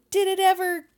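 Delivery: high, loud disbelieving question; incredulous question. Retold or imitated. Asking a cohost whether fur trading ever left, high and loud. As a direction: push it up high in mock disbelief. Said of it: "I'm like".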